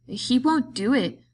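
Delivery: a scared cry that George would never leave. A narrator's reading. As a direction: blurt it out, upset and pleading. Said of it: "Lenny cried".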